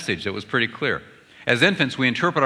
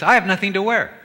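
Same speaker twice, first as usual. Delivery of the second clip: a wife's lament, pitched higher; wife complaining about her wardrobe, higher voice; wife's line; 9 semitones higher, 4 dB louder than usual. Retold or imitated. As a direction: play the wife, up high with a stress on I. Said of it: "A wife says".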